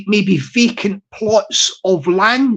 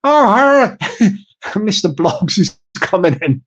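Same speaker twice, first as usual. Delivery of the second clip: ordinary delivery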